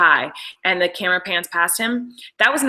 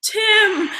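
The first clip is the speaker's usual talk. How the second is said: a high imitated call of a name; 13 semitones higher than usual. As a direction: shout the name playfully, as he did. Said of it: "calling people out by name".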